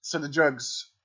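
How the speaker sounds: ordinary talk, nothing marked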